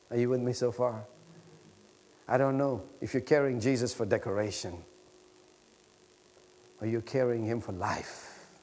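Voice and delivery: ordinary talk, nothing marked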